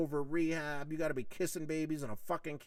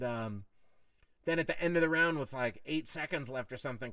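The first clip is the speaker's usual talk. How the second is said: normal talking voice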